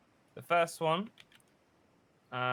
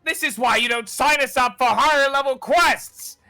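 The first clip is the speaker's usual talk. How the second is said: high, loud exasperated yell; angry elf; 13 semitones higher, 15 dB louder than usual. Acted. Elf scolding the goblin while fleeing, loud angry yell. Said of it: "He goes".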